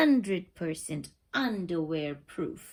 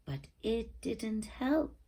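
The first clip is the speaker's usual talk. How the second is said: plain reading voice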